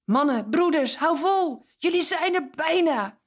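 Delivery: hoog en luid aanmoedigend; aanmoedigend roepen. Acted. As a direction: roep ze toe als een supporter. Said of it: "Wij zouden naar ze willen roepen". (aanmoediging naar de vrienden van Jezus, hoog roepend)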